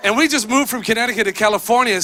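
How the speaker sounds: normal talking voice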